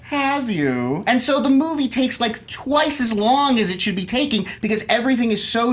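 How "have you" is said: slow, high, plummy Downton Abbey voice; posh drawing room accent. Retold or imitated. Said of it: "they talk like this".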